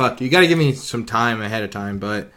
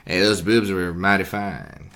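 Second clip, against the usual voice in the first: Matthew McConaughey impression; 6 semitones lower, 6 dB quieter than usual. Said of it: "let me give you my, uh, Matthew".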